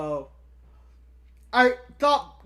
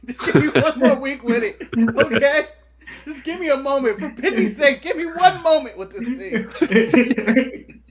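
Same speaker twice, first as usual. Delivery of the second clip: lightly voiced wrestler pleading for more time; plead, building louder, half laughing; Miz impression, pleading; 5 dB louder than usual. Wrestler asking for one more week, mock pleading. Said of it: "the Miz coming out there is like".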